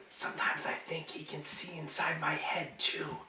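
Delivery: secretive whisper. Acted. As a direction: lean in and confide in a whisper. Leans close, confides.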